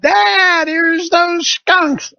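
high, drawn-out mock yell from down the street; daughter yelling. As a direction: call it up like a girl shouting from the front of the house. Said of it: "She yells up".

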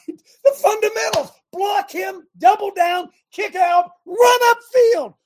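high, loud coaching calls, each one barked out; coach's shouting. Retold or imitated. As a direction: shout the basics at the team from the sideline. Barking football basics like a coach.

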